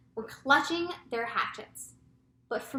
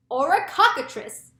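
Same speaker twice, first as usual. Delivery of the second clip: high, loud alarmed yell; urgent police chief's cry; 6 semitones higher, 10 dB louder than usual. A narrator's reading. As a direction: yell out another monster's name. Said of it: "yelled the chief of police".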